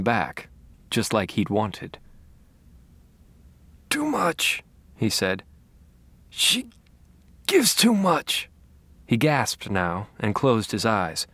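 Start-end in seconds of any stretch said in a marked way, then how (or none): 3.9-4.6 put-on weak old man. Dying Harry speaks in pain. 6.3-8.5 put-on strained old man voice. Dying Harry speaks in pain.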